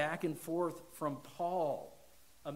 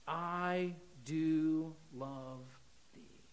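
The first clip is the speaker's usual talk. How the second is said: tender, slow confession; much slower than usual. Acted. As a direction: let the words barely come out, lovingly. Peter's confession, closing prayer.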